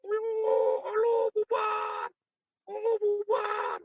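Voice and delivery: very high, slow mocking shout; mock crowd yell. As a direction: ham up a crowd chanting. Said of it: "mereka teriak rame-rame".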